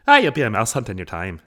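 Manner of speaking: put-on loud, playful Irish-accent repeat. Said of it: "like, an Irish accent".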